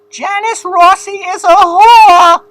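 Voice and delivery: high, slow, loud crying wail; Karen's shriek. Retold or imitated. Karen wailing her rival's name in a loud, high, slow cry. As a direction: wail it like a hysterical mob wife. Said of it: "Did you ever hear Karen say".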